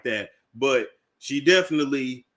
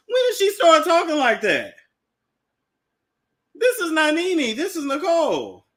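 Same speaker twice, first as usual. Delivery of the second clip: high incredulous questioning; who is this woman; 10 semitones higher than usual. Real, not acted. Host questioning Nene's new L A voice, high and incredulous.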